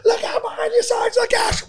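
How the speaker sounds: high warning shout, laughing; retold warning shout. Retelling the video, he voices Tim's high laughing shout. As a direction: yell it like a panicked cop. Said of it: "Tim turns around and goes".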